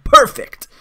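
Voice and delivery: high, loud, delighted burst; excited approval. Real, not acted. Gamer reacting to Surgical Extraction, excited. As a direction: exclaim it with sudden real delight. Reacting to a good card in game.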